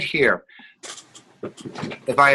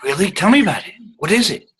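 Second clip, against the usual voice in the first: higher, eager interested questions; excited demo; 5 semitones higher, faster than usual. Shown as a demonstration. Teacher demonstrates excitement from the resonator. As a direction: lean in with real interest. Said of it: "That's the ex-, excitement".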